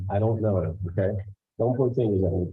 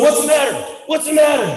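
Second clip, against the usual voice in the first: raised, high, urgent; alarmed shout; 11 semitones higher, 4 dB louder, faster than usual. Retold or imitated. Husband running in to his panicking wife, high raised shout. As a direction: burst in asking what happened. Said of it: "I go running into the room".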